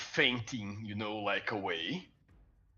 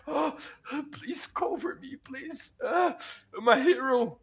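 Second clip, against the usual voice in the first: frightened pleading; 12 semitones higher than usual. Acted.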